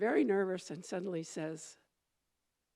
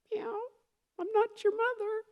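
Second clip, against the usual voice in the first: much higher kitten voice, meowing its answer. A narrator's reading. Kitten telling the baby bird no, in a high mew. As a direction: squeak it out like a little cat.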